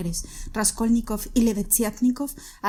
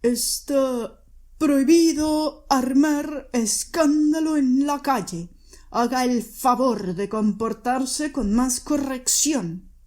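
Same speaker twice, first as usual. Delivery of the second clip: voz de autoridad, lenta; gendarme severo; 3 semitones higher, 4 dB louder, slower than usual. A narrator's reading. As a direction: reprende despacio y con firmeza. El gendarme la reprende. (el gendarme reprende a Catalina con voz lenta y firme)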